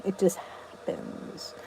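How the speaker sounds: whispered, slow; quiet slow aside. Real, not acted. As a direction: let it fall to a slow whisper. Soft dropped phrase.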